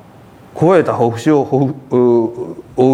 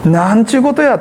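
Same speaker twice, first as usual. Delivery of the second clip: indignant brother; 9 semitones higher, 3 dB louder than usual. Retold or imitated. Brother fuming at the feast.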